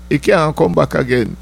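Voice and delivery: usual speaking voice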